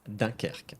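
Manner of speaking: "Dunkerque" as "dan-KAIRK", softly falling; French pronunciation demo. Shown as a demonstration. Teacher confirming the name, soft French "Dunkerque". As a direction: round off the lesson on "Dunkerque". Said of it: "That's Dunkerque".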